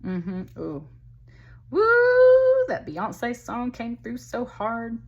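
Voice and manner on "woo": loud, high drawn-out "woo"; excited whoop. Real, not acted. Card reader struck by a song coming through, excited whoop. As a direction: let out a long, piercing whoop.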